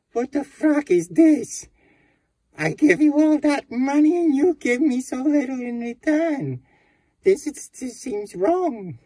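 high, loud outraged yelling; furious shout. Acted. Cheated guest yells at the bank teller, furious.

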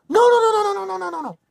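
high and loud, a string of frantic noes; panicked pleading. Acted. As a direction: beg him to stop, in a panic. Panics over getting Luigi.

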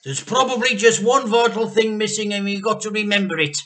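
loud impatient demand; stern demand. A narrator's reading. Mr Cranky interrogating George, loud stern demand. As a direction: press the boy hard.

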